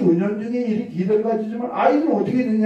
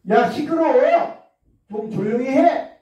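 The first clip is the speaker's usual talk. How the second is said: yelling; 5 semitones higher than usual. Shown as a demonstration. Teacher yelling at noisy class demo.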